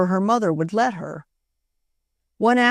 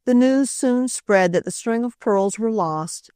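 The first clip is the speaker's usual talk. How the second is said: ordinary delivery, nothing marked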